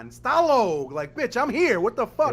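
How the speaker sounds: put-on very high, loud old-lady rant; grandma yelling. Great-grandma outraged at the babysitter, loud mock shriek. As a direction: shriek it like an offended nonna. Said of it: "She's like".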